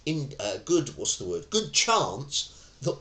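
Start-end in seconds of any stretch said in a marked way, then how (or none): none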